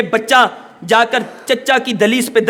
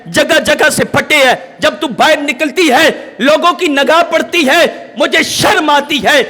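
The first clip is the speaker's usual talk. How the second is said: fiery preaching; 4 semitones higher than usual. Acted. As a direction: preach it hard, scolding the crowd. Preacher rails at society, shouting.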